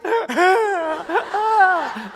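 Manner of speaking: a high, loud laugh that cracks into a sob. Retold or imitated. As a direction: laugh through tears at the memory. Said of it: "Like, literally sobbing".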